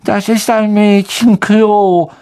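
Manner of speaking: "Das ist ein Mädchenklo" drawn out slowly, high and whiny; suspicious ghost girl. Acted. Myrte to the boys, slow, high and suspicious. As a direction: eye the boys with distrust. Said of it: "musterte Ron und Harry misstrauisch".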